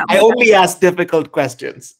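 laughing through a playful jab. Real, not acted. Host teasing the guest about the hard question, laughing.

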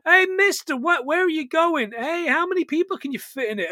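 a hint of a bratty kid pestering; annoying kid voice. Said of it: "He has got the voice of a fifty-year-old man".

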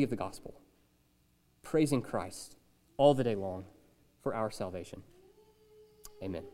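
hushed, reverent prayer voice; quiet pastoral prayer. Acted. Opening prayer before sermon.

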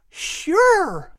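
put-on high, eager child's yes; a little boy keen to go. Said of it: "the little boy answered eagerly".

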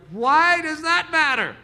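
slow, high, loud protest; incredulous question. Retold or imitated. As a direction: demand an answer in disbelief. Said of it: "all of you are like".

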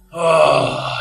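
loud annoyed groan; crowd grumble. Retold or imitated. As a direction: groan like a fed-up passenger. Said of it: "immediate grumble from everyone".

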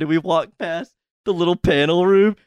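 ordinary talk

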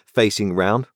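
ordinary delivery

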